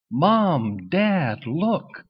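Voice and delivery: high, eager call to his parents; a duckling showing off to mom and dad; excited call. A narrator's reading. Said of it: "Buzzy cried".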